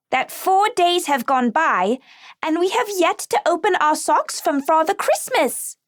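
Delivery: put-on a little girl bursting to ask about her stocking; Lucy pleading about the Christmas socks; eager child. Excited child Lucy asks.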